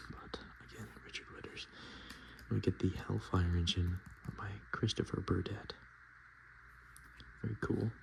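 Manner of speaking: usual speaking voice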